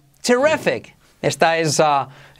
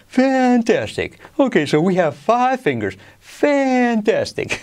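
high, stretched-out puppet frog voice; Kermit the Frog; 8 semitones higher, slower than usual. Acted. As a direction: put on the frog puppet, drawing out words. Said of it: "en un momento dado dice". (voz aguda de la rana Gustavo, alargando palabras)

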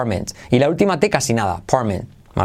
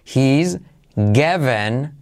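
He's given stretched very slowly; slow pronunciation demo; much slower than usual. Shown as a demonstration. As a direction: drag out "He's given" word by word. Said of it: "semiclave H". ("He's given" muy despacio)

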